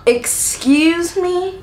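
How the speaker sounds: drawn-out, high "excuse me"; incredulous. Retold or imitated. Niece hears her aunt won't come, incredulous. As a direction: stretch it out in offended disbelief. Outraged reaction to cancelled trip.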